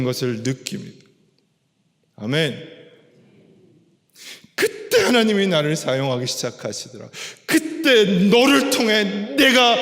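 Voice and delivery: impassioned preaching. Real, not acted. Preacher telling the congregation when God begins to work, impassioned. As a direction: drive each phrase home with rising force.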